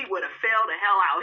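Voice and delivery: ordinary delivery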